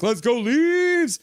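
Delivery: high, loud, slow chant; cheer. Retold or imitated. Streamer reading a viewer's cheer, a drawn-out chant. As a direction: chant it for the Leafs. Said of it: "Bobby's like".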